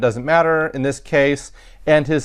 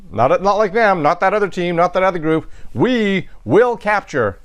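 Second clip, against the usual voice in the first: teacher showing contrastive emphasis; emphatic we; 3 semitones higher than usual. Shown as a demonstration. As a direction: lean on we, not that other team. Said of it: "A clue for emphasis in this sentence is we".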